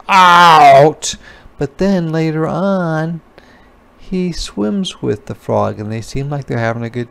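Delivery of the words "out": loud croaked "Out!"; retelling the frog's croak. Retold or imitated. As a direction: croak it like the frog. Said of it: "the frog croaks".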